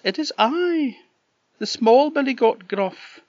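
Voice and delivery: very high, tiny goat voice; little goat voice. Acted. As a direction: squeak it out like a small goat. Small goat answers the troll.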